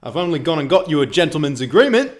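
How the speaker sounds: playing Harry Kane's brother. Harry Kane's brother brags he got a deal, loud mock boast. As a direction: boast like a self-made agent. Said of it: "our impression of Harry Kane's brother".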